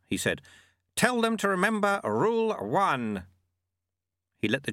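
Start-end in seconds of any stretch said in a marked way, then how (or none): 0.9-3.2 put-on raised, calm old sweeper; old monk voice. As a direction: voice the sly old monk lightly. Narrator voices Lutze.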